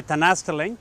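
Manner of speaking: "Tanasteling" said as a warm Amharic greeting, rising gently at the end; Ethiopian greeting. Shown as a demonstration. Explaining an Ethiopian greeting to the viewers, says it plainly. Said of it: "that's a greeting that you would hear in Ethiopia".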